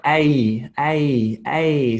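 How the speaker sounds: A as a gliding diphthong; combined vowel sound. Shown as a demonstration. Teacher combining two vowels for students, gliding diphthong demo. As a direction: glide from open vowel into EE on each A. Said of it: "two vowels combined".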